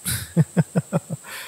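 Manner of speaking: high, rippling little chuckle; light chuckle. Acted. Talking of fighting the listener's friend, amused chuckle. Villain amused amid monologue.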